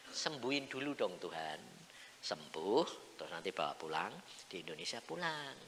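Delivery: soft plea to God; quiet request. Acted. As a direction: ask gently for healing. Memohon pelan kepada Tuhan. (permohonan pelan)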